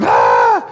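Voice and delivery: a sudden sharp "Ah!" shouted to scare cattle off; short yell at cows. Shown as a demonstration. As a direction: bark one loud syllable to send the cows running. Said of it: "all you have to do is, like".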